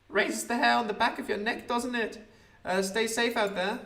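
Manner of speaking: high piping lad's voice; the newsboy answers the small man, high and piping. Acted. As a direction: chirp it like a cheeky small boy. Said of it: "I'm Little Rob".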